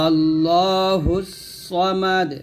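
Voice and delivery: "সামাদ" with a thick emphatic s, slowly drawn; heavy sad. Shown as a demonstration. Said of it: "সা বলবেন না". (মোটা সদ দিয়ে ধীরে "আল্লাহুস সামাদ")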